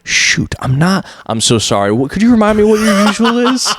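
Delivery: feigned forgetfulness. Retold or imitated. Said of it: "pretend like I did not remember".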